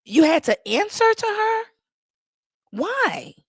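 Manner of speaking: high incredulous questions; high-pitched mock shock; mock disbelief. Real, not acted. Incredulous reaction.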